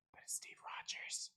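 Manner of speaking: breathy stage whisper of a secret; hushed conspiratorial aside. Real, not acted. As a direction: lean in and whisper the name.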